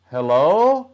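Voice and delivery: loud, high call into the dark; a boy calling out into the night. A narrator's reading. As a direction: call out to a stranger, unsure. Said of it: "Chomnon calls out".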